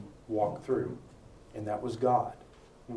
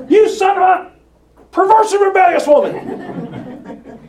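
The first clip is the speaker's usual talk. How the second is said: much higher and louder, laughing through a hammed-up roar; mock furious shout; 15 dB louder than usual. Preacher acting out Saul cursing Jonathan, mock furious laughing yell. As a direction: blow up like Saul losing it, half laughing. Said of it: "does anyone in this room think that's what he said".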